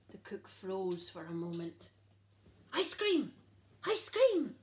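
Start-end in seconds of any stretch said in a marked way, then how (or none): none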